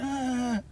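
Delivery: high wailing ah; a short imitated crying wail; mock sob. Imitating the clerk breaking down crying, high wail. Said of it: "she started crying".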